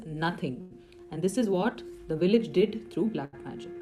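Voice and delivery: ordinary talk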